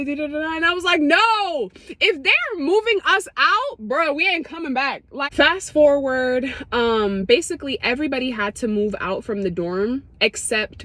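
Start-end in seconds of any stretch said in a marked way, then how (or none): 1.0-5.0 insistent. Retold or imitated. Insisting to friends.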